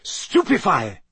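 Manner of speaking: high, falling cry of a spell; shouted spell. A narrator's reading. Harry casts a spell at monster.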